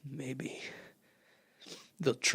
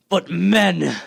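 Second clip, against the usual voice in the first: triumphant cry; 4 semitones higher, 9 dB louder than usual. Acted. Artilleryman imagines seizing machines.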